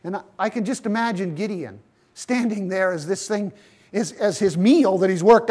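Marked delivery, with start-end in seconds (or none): none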